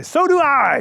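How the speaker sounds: high, little creature voice. A narrator's reading. Mole pipes up to young man by the river, little voice. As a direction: pipe up in a small high voice. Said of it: "he hears a little voice say".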